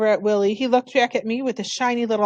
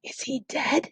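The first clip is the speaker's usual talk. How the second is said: soft, hushed frightened question; scared boy whispering to his sister about Mookie; 4 semitones higher, 7 dB quieter, slower than usual. A narrator's reading. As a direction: barely breathe the words. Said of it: "Toby whispered".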